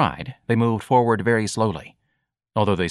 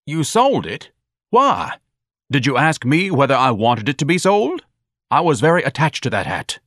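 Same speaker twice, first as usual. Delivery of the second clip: high, loud, affronted questioning; indignant outrage; 6 semitones higher, 4 dB louder than usual. Acted. Pompous son to mother over his sold hat, indignant. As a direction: demand an explanation, pitch leaping up.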